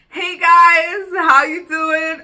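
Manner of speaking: loud, high, drawn-out hello; excited greeting. Acted. Welcoming viewers back, loud and slow. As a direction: greet the viewers with big energy, slowly. Restarting the vlog intro.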